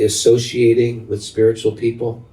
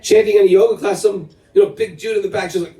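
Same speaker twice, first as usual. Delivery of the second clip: normal talking voice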